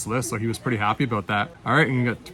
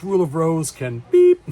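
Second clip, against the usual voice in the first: loud, high mock excitement; thrilled buyer bursting out, hammed-up; imitated excited customer; 5 semitones higher, 10 dB louder than usual. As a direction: retell a pumped customer's swearing, censored with a beep. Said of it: "He was just so excited".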